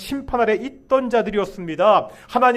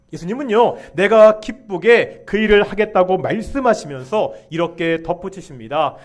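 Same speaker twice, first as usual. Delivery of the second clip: ordinary talk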